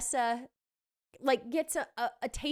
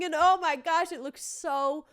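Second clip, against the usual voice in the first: ordinary talk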